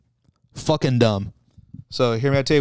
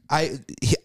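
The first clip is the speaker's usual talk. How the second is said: ordinary delivery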